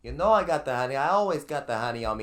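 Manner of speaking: loud, high comic mobster voice; boisterous wiseguy Sal. Acted. Sal assuring his pal about the honey, hollering. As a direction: holler back like a cocky goon. Playing Sal answering the henchman.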